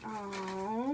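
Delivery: ordinary delivery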